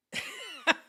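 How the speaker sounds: high-pitched burst of laughter. Real, not acted. Storyteller laughing at being called out in class, high laugh. Laughing at his own retold embarrassment.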